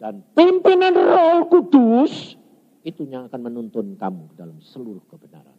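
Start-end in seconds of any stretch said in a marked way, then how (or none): 0.0-2.3 high, slow proclamation; forceful preaching. Real, not acted. Preacher urging congregation to follow the Holy Spirit, forceful. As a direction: draw it out high and strong.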